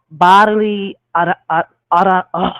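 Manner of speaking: stumbling on the start of "anatomy"; real tongue twist, halting false starts; tongue-tied. Real, not acted. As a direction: trip over the word, restarting as "ara-". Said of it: "Don't mind my tongue twisting".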